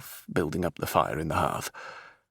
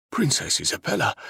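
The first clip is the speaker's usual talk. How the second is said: stunned recognition; 11 semitones higher than usual. Acted. Captain recognising her.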